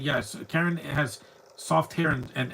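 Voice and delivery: ordinary talk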